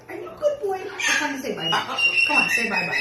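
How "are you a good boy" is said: talking to a dog. Real, not acted. Owner coos at pet.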